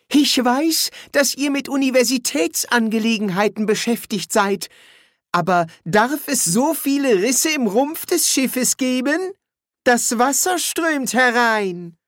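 a housekeeper pointing out the leak politely; worried housekeeper. Acted. Housekeeper warns the wizards water is coming in, high and fretful.

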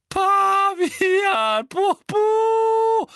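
high, loud panting through laughter; gasping for air. A narrator's reading. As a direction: gasp the words out between laughs. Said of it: "keuchte sie". (keuchend vor Lachen)

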